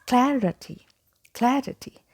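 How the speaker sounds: clarity as CLA-ruh-tee; correct pronunciation. Shown as a demonstration. Teacher models clarity, correct pronunciation. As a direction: say "clarity" with cat's a and weak middle. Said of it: "The correct pronunciation is".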